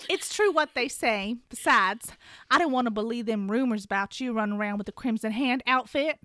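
a hammed-up Southern gossip tone; Southern accent. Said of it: "Shen Yun is gonna be played by myself".

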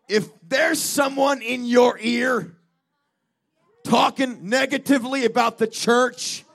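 fired-up revival preacher; full-force preaching. Real, not acted. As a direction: preach it loud to the whole congregation, drawing out key warnings.

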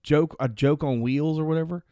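ordinary talk

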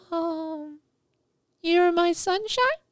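put-on very high, hesitant child's voice; shy little boy. Shy boy pulled up to the piano, high and hesitant. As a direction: stammer it out like a shy kid.

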